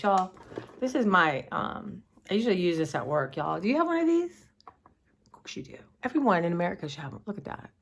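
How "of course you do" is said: whisper. Real, not acted. Aside to viewers.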